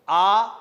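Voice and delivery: "आ" held long; long aa vowel. Shown as a demonstration. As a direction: draw "आ" out. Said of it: "समय ज्यादा लगा". ("आ" का लंबा उच्चारण)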